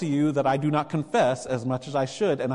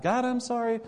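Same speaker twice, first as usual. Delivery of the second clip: much higher, quick rushed prayer of a sorry sinner; confessing sinner; 10 semitones higher, faster than usual. Retold or imitated. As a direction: blurt out the apology fast and high. Voicing a penitent's prayer.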